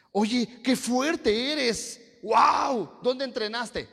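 high, drawn-out acted gushing with a loud wow; amazed admiration. Preacher plays someone admiring another's strength, amazed. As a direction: exclaim in hammed-up awe, pitch up high.

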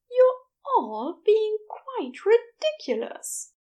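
rolling his eyes at the fuss; Aziraphale, fondly exasperated. A narrator's reading. Aziraphale voiced in story.

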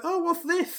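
put-on high, light voice of a curious boy; a kid innocently poking at a bottle. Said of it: "He's like".

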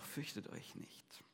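whispered, slow and soothing; soft reassurance. A narrator's reading. Jesus calming his terrified disciples, whispered reassurance. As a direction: hush their fear gently.

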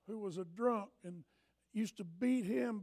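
ordinary talk, nothing marked

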